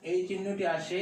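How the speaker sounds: ordinary talk, nothing marked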